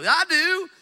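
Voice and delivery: high, loud, proud reply; whole crowd answering the pastor's question; mock eager answer. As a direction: call it out like a keen crowd. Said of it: "raise their hand and go".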